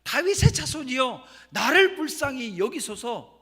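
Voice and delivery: loud, high, urgent plea. Acted. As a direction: shout it out over those hushing you, stretching the plea. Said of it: "더욱 크게 소리 질렀다고".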